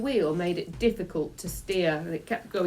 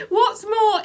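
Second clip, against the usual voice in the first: laughing through the words; real laughter breaking the narration; 7 dB louder than usual. Real, not acted. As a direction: crack up mid-read. Reader cracks up.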